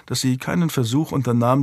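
ordinary delivery, nothing marked

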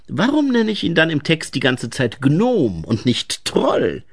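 plain reading voice

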